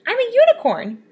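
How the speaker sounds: thrilled to share big news; excited announcement. Acted. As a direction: blurt it out with pride.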